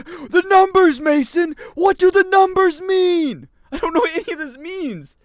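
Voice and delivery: loud, high, desperate demand; hammed-up interrogation shout. Demanding answers from Mason about the numbers, hammed-up shout. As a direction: yell it like a movie interrogator, drawn out. Mock interrogation outburst over confusing game.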